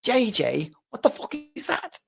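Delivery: high, disbelieving mock Northern retort; mocking Northerner. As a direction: scoff at the odd nickname. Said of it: "they're turning around going".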